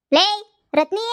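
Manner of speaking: loud rising call across the house. Acted. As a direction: yell for her to come. Calling daughter from afar.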